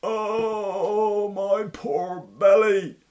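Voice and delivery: slow, high, loud moaning lament; hammed-up pained groan. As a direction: groan it clutching your gut. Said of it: "groaned Denbras".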